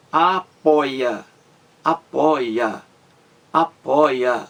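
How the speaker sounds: apoia slowly split as a-POI-a; syllable by syllable. Shown as a demonstration. Teacher counting out syllables for students, apoia said syllable by syllable. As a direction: break apoia into three beats, weight on poi. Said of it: "São três sílabas".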